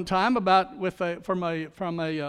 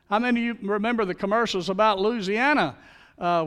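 normal talking voice

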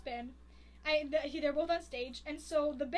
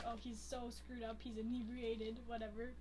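1 mildly sad inner thought; 5 semitones lower, 11 dB quieter than usual. Retold or imitated. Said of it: "like, all sad".

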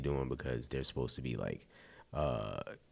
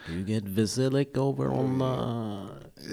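slow, higher mock Russian accent; 7 semitones higher, 5 dB louder, slower than usual. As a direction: drag it out in a bad Russian accent. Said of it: "Your accent is terrible".

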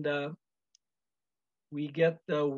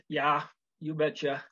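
put-on a light Minnesota accent, Norwegian flavored; mild Upper Midwest accent; 2 semitones lower than usual.

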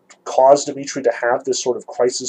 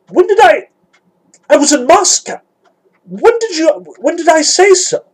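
high, loud, flustered stammer; stammering, at a loss; 9 dB louder than usual. A narrator's reading. Ivan caught off guard by Alyosha's accusation, stammering high. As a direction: stumble over the question, much higher and louder. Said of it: "Ivan stammered, completely at a loss".

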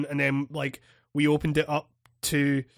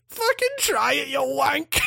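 defiant shout; 8 semitones higher than usual. Retold or imitated. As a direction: throw the dare back at him, shouting. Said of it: "And I was like".

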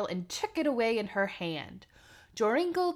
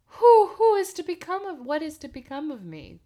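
hammed-up lament that fades away; mild lament; 7 semitones higher, 9 dB louder than usual. A narrator's reading. Narrator voices Joringel mourning Jorinda, theatrical lament. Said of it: "he lamented".

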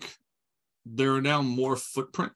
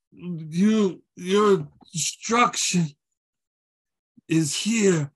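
higher-pitched, halting woozy voice; woozy captive; 5 semitones higher, much slower than usual. Acted. Wounded captive threatens the party, woozy and halting. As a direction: slowly drag out a faltering threat. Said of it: "feeling a bit woozy right now".

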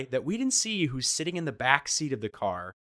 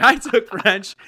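higher, louder echo of his answer; mock despair at the useless reply; exasperated; 9 semitones higher, 11 dB louder, slower than usual. To his buddy, mock exasperated repeating him. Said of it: "And I was like".

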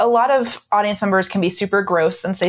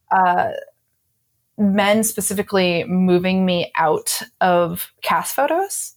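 usual speaking voice

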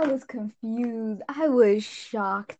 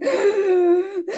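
mock gasp; 8 semitones higher, 5 dB louder than usual. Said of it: "I was like".

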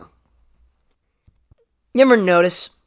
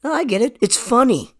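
mocking Clayton; 8 semitones higher than usual.

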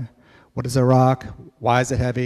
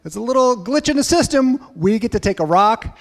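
much higher, gushing and giddy; mock excited kids; 10 semitones higher, 4 dB louder than usual. Kids delighted they may keep a rock, high giddy. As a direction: play kids thrilled at a loophole. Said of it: "So they're like".

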